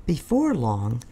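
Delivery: usual speaking voice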